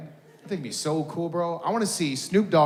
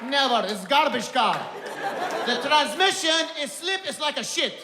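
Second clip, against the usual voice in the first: put-on Arabic accent; 9 semitones higher than usual. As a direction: haggle indignantly in a thick Arabic accent. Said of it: "I can just do the accent well".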